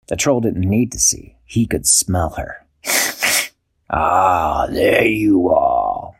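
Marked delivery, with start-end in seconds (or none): 2.8-3.5 troll chuckle. Acted. Troll finds Dashy. 3.9-6.2 low, slow troll growl; menacing troll. Acted. Troll finding Dashy hiding in bed, slow low menace.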